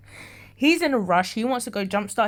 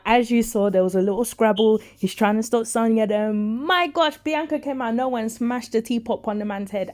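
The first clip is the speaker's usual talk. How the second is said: normal talking voice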